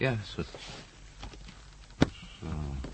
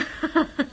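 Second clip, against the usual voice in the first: surprised laugh. Acted. Recognizes old friend.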